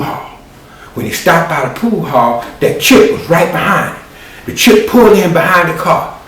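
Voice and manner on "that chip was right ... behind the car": ordinary talk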